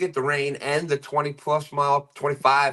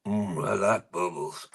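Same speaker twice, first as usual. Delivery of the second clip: low, soft character voice; Sling Blade; 6 semitones lower, 5 dB quieter than usual. Acted. Reading a chat joke from Jody, Sling Blade voice. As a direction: drop it down low for a film-character impression. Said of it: "In my best sling blade, sling, sling blade voice".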